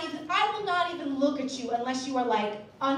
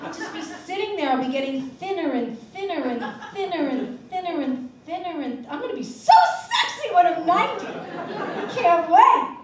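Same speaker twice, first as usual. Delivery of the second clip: laughing; 5 semitones higher, 7 dB louder than usual. Real, not acted. Laughing through the joke.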